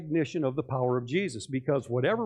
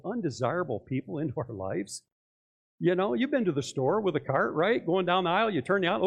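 usual speaking voice

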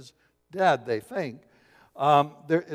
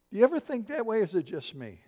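ordinary delivery

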